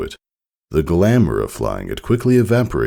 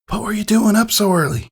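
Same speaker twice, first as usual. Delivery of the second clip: whispering filly. Acted. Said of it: "Applebloom whispered".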